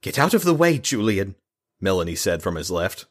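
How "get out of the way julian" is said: gritted-teeth command; through clenched teeth. Acted. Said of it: "through clenched teeth".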